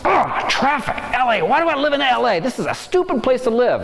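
hammed-up gripe about traffic and LA; mock whiny complaining. Stuck in traffic, whining to himself about LA, high mock complaint. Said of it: "We can choose to sit there and go".

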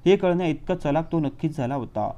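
ordinary delivery, nothing marked

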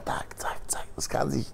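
quick whispered aside. Acted. Speaker to listeners about fate turning, whisper. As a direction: hush it like a secret. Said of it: "whispered aside about fate".

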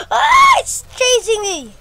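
gasp, then high panicked shout; being chased. Real, not acted. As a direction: scream while running away. Enemy chasing in game.

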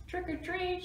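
high-pitched, lightly voiced shout. A narrator's reading. Spike at the old house door, calling out high. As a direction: sing out a high storybook call. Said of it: "shouted Spike".

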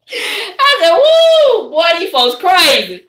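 high, loud whoop easing down; whooping amusement. Retold or imitated. Streamer reacting to a comedian's church joke, loud amused whoop. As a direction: whoop it up high, then slow on the words.

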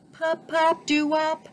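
high, popping fish voice; bubbling fish. A narrator's reading. Fish flips up to join the band, high bubbly voice. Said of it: "bubbled Fish".

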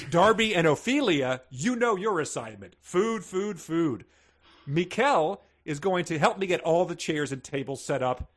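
brisk bossy reading of orders; lightly voiced commanding hostess; reading Marisol's orders. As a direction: read it like a general giving orders. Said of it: "said Marisol".

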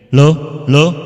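loud, firm test calls; two punchy sound-check calls into the mic. Shown as a demonstration. Teacher checking a thicker tone setting, loud mic-check call. Testing microphone at new setting.

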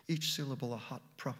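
ordinary delivery, nothing marked

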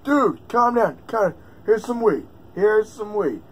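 high, loud mock-soothing; mock calming. Owner acting out calming his hamster, high and loud. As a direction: talk him down like a stoner friend. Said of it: "I'm like".

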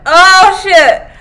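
loud, high cry of shock; shocked outburst. Real, not acted. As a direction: blurt it out loud and high, half laughing. Startled reaction to the story.

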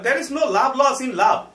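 slow, loud, high Bengali-accented English; hammed-up Banglish pronunciation demo. Shown as a demonstration. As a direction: overdo the Banglish "labh" for love. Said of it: "এই ভালো লাগে না".

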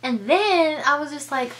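ordinary delivery

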